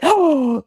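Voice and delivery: sharp high gasp of shock; loud shocked gasp. Real, not acted. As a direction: gasp loudly as if scandalised. Looking at the jut.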